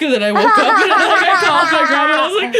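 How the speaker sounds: high, loud laughing telling. Real, not acted. Telling friends how she woke up, high and laughing. Laughing retelling of waking scared.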